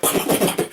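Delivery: unvoiced pop pop of firing; whispered gun pops. Imitating his gun firing.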